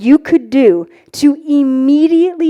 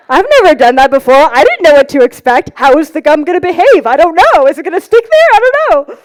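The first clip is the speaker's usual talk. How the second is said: louder, fast and excited; 2 semitones higher, 5 dB louder, faster than usual. Acted. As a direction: burst with giddy excitement. Reliving gum excitement.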